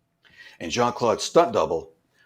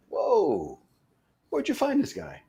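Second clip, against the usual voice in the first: surprised exclaim; 10 semitones higher than usual. Retold or imitated. Jean-Claude reacts to meeting Todd.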